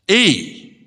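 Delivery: a hint of a broad Yorkshire lad, blurted; a stage-frightened boy blurting in a touch of Yorkshire; Yorkshire accent. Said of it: "blurted out in his broad Yorkshire accent".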